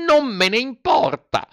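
very high bratty retort; defiant Pinocchio. Acted. Puppet brushes off the fairy's warning, defiant. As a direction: answer back in a high childish voice.

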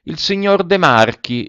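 high, playful ironic answer; name him with sly relish; maliciously amused, lilting high; mischievous irony. A narrator's reading. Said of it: "brillarono d'un'ironia maliziosa".

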